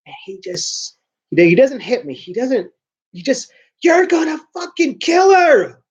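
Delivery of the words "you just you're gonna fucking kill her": high, loud furious yell; dad yelling from the doorway; dad's shout. Retold or imitated. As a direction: scream it like a furious father. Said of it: "my dad wakes up".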